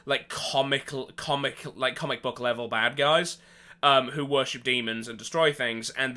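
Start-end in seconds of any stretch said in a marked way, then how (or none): none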